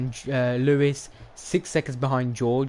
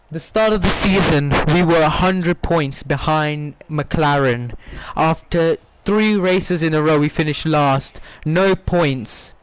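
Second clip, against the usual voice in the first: loud, fired-up storytelling; excited recap; 5 dB louder than usual. Real, not acted. Streamer loudly recapping the season for his viewers, fired up. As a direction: belt out the comeback story to the stream.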